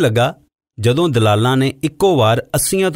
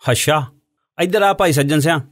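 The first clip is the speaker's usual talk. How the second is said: mild scolding; 4 semitones higher than usual. A narrator's reading. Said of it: "ਜ਼ਰਾ ਝਿੜਕਦਿਆਂ ਕਿਹਾ".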